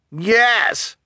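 high, eager cry of agreement; sudden high yes from a heckler; crowd shout. A narrator's reading. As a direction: call out from the crowd. Crowd member calls out agreement.

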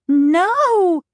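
high, loud cry of horror; mother cries out at the sight; horrified cry. Acted. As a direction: cry out in shock. Mother horrified by two fathers.

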